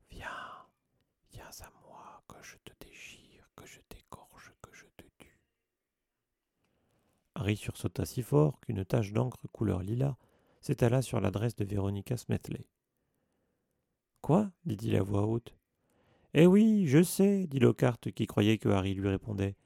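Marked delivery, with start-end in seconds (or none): 0.1-5.4 cold breathless whisper, very soft; icy venomous whisper. Acted. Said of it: "une voix glacée comme un venin". 14.2-14.5 sudden high loud cry; startled. Acted. Harry startled by the voice. 16.3-17.5 much higher, louder, gloating; boastful delight. Acted. Lockhart boasting loudly.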